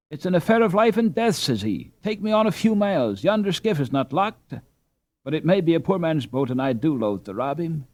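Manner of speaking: the lad quoted. Retold or imitated. Ralph retelling the lad's plea, mildly urgent voice. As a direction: plead urgently as the fleeing lad.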